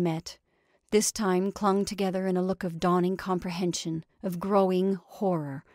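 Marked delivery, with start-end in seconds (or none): none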